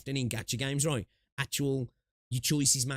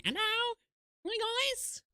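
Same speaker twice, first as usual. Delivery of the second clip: high-pitched. Pretend. Mock greeting in a high voice.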